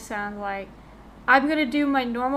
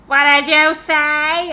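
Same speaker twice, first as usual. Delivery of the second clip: mom shouting; 3 semitones higher, 10 dB louder, slower than usual. Retold or imitated. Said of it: "my mom is like".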